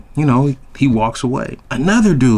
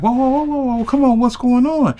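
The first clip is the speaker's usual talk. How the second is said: much higher, lightly voiced pleading "whoa"; a hint of the pleading woman; 12 semitones higher than usual. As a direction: imitate her trying to hold him back. Woman stopping him leaving.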